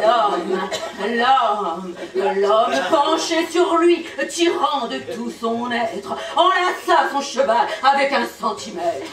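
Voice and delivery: halting start, then emphatic verse; theatrical poetry delivery; poem recitation. Acted. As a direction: declaim it like an epic tale.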